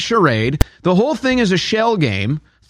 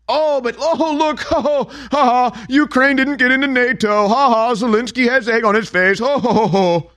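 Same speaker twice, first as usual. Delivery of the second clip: higher, hammed-up taunting cackle through the words; mock gloating laughter; 5 semitones higher than usual. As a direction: jeer like a smug pundit celebrating. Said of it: "and we're saying".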